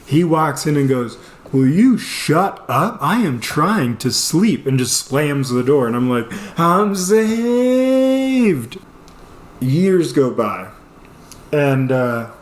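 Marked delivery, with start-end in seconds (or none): 1.5-4.7 slow, emphatic mock exasperation; annoyed brother. Brother woken by screaming, slowly exasperated. 6.6-8.8 high, hugely stretched wail of relief; overjoyed relief. Retold or imitated. Trapped man to his brother, ecstatically relieved.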